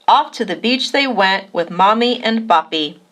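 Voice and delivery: ordinary delivery, nothing marked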